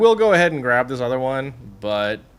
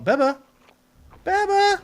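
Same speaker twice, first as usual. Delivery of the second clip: calling a pet. Real, not acted. Calling to a tamed animal.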